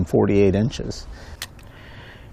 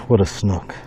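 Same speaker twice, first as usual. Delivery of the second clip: ordinary talk, nothing marked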